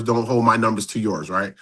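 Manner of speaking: ordinary talk, nothing marked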